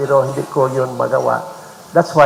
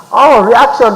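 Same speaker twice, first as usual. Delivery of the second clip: ordinary talk, nothing marked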